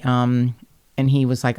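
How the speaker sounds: ordinary talk, nothing marked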